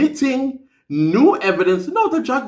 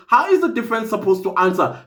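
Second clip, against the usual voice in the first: normal talking voice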